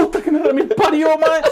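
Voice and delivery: high, strained cry of pain from a leg cramp; pained outcry. Real, not acted. Friend's leg cramps mid-podcast, he cries out in real pain. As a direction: yelp it as the cramp grabs.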